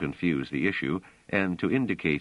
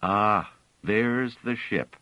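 slow, lifted triumphant cry; 4 semitones higher, slower than usual. A narrator's reading. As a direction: announce the ship in triumph.

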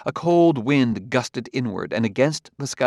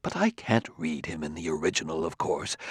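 lower, softer aside; confidential; 5 semitones lower, 7 dB quieter than usual. A narrator's reading. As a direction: let them in on a small secret. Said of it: "continued with a confidential air".

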